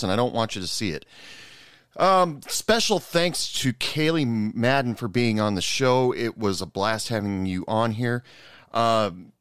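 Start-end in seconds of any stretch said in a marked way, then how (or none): none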